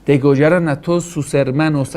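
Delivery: normal talking voice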